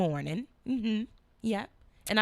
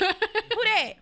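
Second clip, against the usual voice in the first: raised, high retold challenge; mock alarmed demand; 10 semitones higher, 6 dB louder than usual. Mother confronting son over a voice, mock alarmed demand. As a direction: demand it sharply, playing it up. Parent bursting into son's room.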